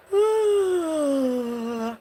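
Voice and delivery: long, high, sighing yawn; a full contagious yawn; big yawn. Real, not acted. Streamer yawning on air, long sighing yawn. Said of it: "that's what you probably hear me doing".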